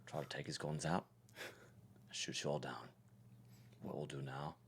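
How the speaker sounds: put-on low, very quiet gangster accent; Tommy Shelby. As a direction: attempt a brooding low Peaky Blinders accent. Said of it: "Going to do the accent this time".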